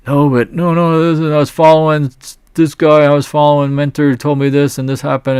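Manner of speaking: high, loud mock protest; whiny excuse-making trader. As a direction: play the trader who blames everyone else.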